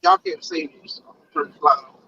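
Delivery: usual speaking voice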